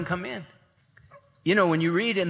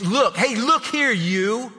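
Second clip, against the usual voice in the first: rude son snapping, higher and pushy; disrespectful son; 6 semitones higher, slower than usual. Retold or imitated. Older son mouthing off to his father, rude. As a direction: brush the father off with a curt "look here". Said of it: "You don't talk to your father like that".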